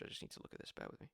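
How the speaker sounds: whispered, reading a message; whispered aside. Real, not acted. Coach pausing the video to check a Slack message, whispered. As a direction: mutter it under your breath. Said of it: "I'm just getting a Slack message".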